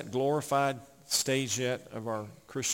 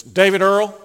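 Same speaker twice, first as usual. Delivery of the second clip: loud, higher mock summons by full name; imitated loved one's scolding call; 5 semitones higher, 11 dB louder than usual. Beloved relative calling the preacher to attention, mock scolding shout. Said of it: "when she says".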